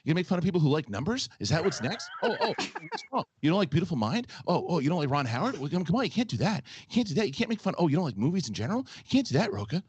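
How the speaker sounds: high, fast, fretful whine of outrage; an overwrought worrier piling on objections; personified anxiety. Pretend. Mocking a cohost for voice impressions, as anxious whiner. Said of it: "you just personified anxiety in a voice".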